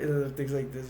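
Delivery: ordinary talk, nothing marked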